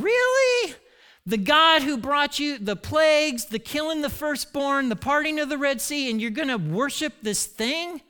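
high-pitched mock disbelief; incredulous. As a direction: leap up high on the first word, stay indignant. Voicing listeners' disbelief at calf worship.

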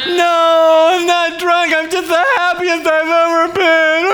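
put-on high, loud, beaming denial of being drunk; euphoric. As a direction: insist through giddy joy. Overjoyed friend denies being drunk.

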